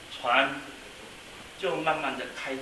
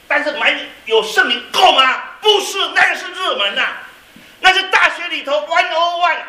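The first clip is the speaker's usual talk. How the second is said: fiery sermon climax, pounding each phrase; full-force preaching; 9 semitones higher, 12 dB louder than usual. Acted. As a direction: drive the point home from the pulpit.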